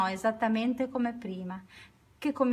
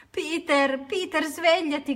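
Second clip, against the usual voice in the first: higher, louder desperate call of Peter's name; shout; 7 semitones higher, 6 dB louder, slower than usual. A narrator's reading. Judy shouts at her sleeping brother to wake up. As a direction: yell to wake him, urgently. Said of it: "gridò Judy".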